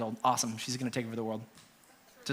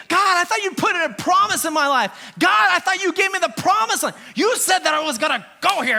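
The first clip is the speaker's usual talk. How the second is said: mock desperate prayer; 4 semitones higher than usual. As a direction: cry out to God like a let-down child. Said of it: "on your knees crying, saying".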